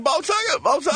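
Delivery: high yelled name over and over; repeated yell. Retold or imitated. Tourette's guy yelling on the swing set. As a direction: shout it again and again, high. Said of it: "running around a swing set yelling".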